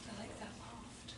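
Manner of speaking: barely audible, slow whisper; imitated whisper. Boy passing the teacher in the loft, imitated whisper. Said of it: "when you've already showed three whispers".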